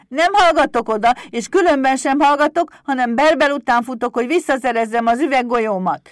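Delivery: high, very loud stubborn kobold; defiant shouting. A narrator's reading. Pumukli cuts off Éder, high defiant shouting. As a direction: shout back like a defiant imp. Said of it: "kiabált dacosan".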